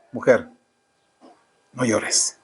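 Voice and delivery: soft, low and gentle, like comforting someone in tears; tender consolation. Retold or imitated. Jesus to a weeping woman, soft and tender.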